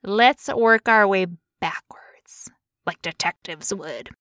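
conspiratorial detective whisper. Pretend. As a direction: whisper it like a sleuth.

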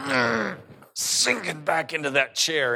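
a lightly acted wince of pain; pained flinch. Dental patient as the nerve gets hit, mock pained.

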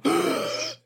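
sharp high gasping death gurgle. Shown as a demonstration. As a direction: gasp like life is drained out. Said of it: "and I kinda go".

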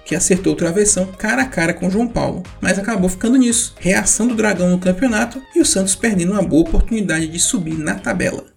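ordinary talk

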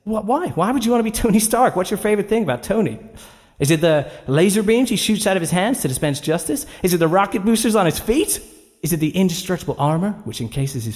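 high, incredulous mother voice; questioning mum. Acted. Mother quizzes her son about Tony Stark, high and incredulous. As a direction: pile up the questions as the astonished mum. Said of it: "She said".

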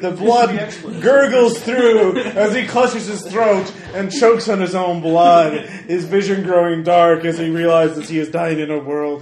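slow, grand storyteller build; dramatic narration. A narrator's reading. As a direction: paint the kill like an epic. GM narrates gory death.

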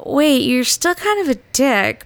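grudging. Retold or imitated. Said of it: "it ended up as".

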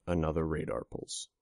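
plain reading voice

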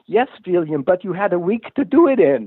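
put-on a light Norwegian accent, patient and dry. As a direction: gently scold him as the Norwegian boss. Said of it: "in his wonderful Norwegian accent".

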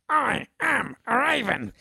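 high, slow squawky raven. Acted. Mocking the actor's raven performance, high slow squawk. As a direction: croak it out as a mock raven. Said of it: "and then you went".

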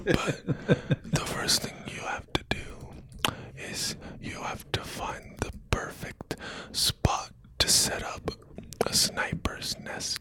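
soft hypnotic whisper; ASMR whisper. Pretend. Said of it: "you're doing the Manchurian Candidate".

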